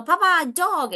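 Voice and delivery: put-on small child. Child showing papa a dog, high imitated call. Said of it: "I hear kids say".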